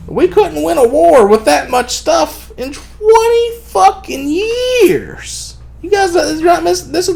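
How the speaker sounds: high, slow, cracking outraged shout; incredulous cry at a lost war; exasperated yell. Real, not acted. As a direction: drag it out, voice breaking with outrage. Ranting about twenty-year war.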